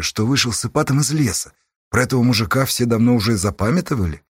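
ordinary delivery

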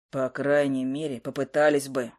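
gloomy mutter. A narrator's reading. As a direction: mutter it sullenly. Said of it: "хмуро пробормотал сова". (хмуро пробормотал сова)

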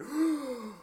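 sharp high gasp of shock; mock gasp. Record execs gasping in mock shock.

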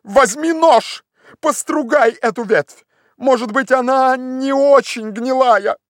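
high, slow call upward; Legrand urging anxiously. Acted. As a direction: call instructions up to the treetop.

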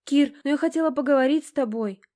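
high, pouty entreaty; pleading whine. A narrator's reading. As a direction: whine it to keep him from leaving. Girl pleads not to be left.

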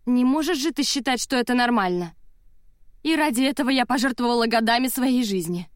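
indignant, wounded outburst; indignant protest. A narrator's reading. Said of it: "возмущённо воскликнула она".

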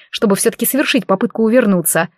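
plain reading voice

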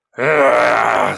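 short loud roar; angry roar. Acted. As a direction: roar with rage. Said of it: "зарычал он". (яростный рык)

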